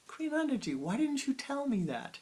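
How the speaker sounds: high, loud, astonished cry to the guru; shocked inner cry. Retold or imitated. In my mind asking Kriyananda why, shocked high cry. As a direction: blurt it out in total shock. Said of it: "shocked look on my face".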